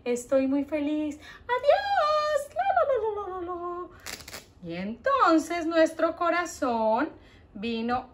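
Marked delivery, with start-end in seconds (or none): 1.5-3.9 high sing-song goodbye; happy heart puppet. Acted. Heart puppet leaves the children, high sing-song. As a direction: skip away humming.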